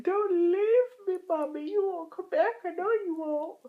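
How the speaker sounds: dog voice, pleading. Acted. Owner voicing her dog begging her not to leave, high crying plea. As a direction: cry like an abandoned puppy. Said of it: "But Andy's like".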